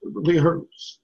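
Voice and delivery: tearful, voice straining; teary-eyed. Real, not acted. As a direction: close to crying. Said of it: "It's teary-eyed".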